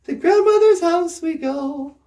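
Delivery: ordinary talk, nothing marked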